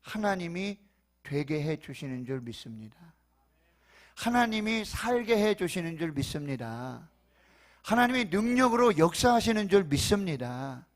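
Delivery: high, loud fervent preaching; preaching at full force. Real, not acted. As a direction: preach it out with rising fire. Preaching climax.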